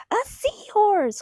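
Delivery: a high, slow, delighted cry of discovery; excited cry. A narrator's reading. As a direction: call it out with pure joy at finally finding it. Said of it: "cries Pete".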